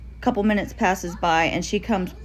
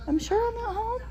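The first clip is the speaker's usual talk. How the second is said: much higher, soft retold reply; high quoting voice; 6 dB quieter, faster than usual. Her own retold reply.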